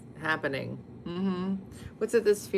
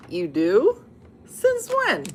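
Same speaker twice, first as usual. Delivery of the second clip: mock disbelief; 9 semitones higher, 9 dB louder, much slower than usual. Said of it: "Everybody's like".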